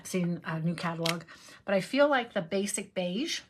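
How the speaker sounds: normal talking voice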